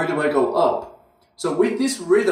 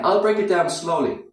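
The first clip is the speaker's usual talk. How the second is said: normal talking voice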